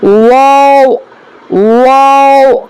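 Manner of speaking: واو with rounded lips; careful model of واو; letter demo. Shown as a demonstration. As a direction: say واو slowly. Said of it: "اگلا حرف کیا ہے".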